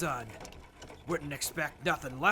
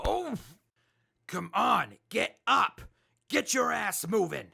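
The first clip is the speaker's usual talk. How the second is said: rough wake-up shout; 7 semitones higher, 6 dB louder than usual. Acted. Raider rousing a captive.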